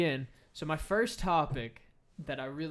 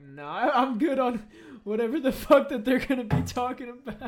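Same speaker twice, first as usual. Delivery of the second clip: dismissive celebrity voice; 10 semitones higher, 9 dB louder than usual. Retold or imitated. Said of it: "probably be like".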